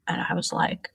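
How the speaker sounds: hushed, breathy lead-in to the shock; whispered aside. Retold or imitated. Whispering to the host about the moment he left. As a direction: drop to a whisper for the setup. Retelling shock of husband leaving.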